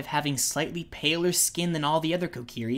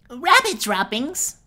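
higher, slow and louder, appalled; 8 semitones higher, 7 dB louder, slower than usual. Acted. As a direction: recoil at the words. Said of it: "sounding appalled".

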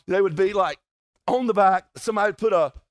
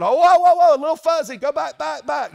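high, loud sing-song call to stop the antenna; brother calling out; 9 semitones higher, 7 dB louder than usual. Retold or imitated. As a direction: sing it out high to the one on the roof. Brother calling out while aiming the antenna.

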